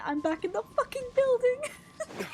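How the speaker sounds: high, whining mock outcry; mock whiny wail. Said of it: "You guys know what that mean?".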